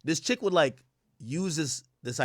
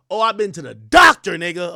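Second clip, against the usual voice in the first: mock shout; 2 semitones higher, 11 dB louder than usual. Girl shouts at him about the doctor, loud mock defiance. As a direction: throw it at him like proof. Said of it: "She gave me the".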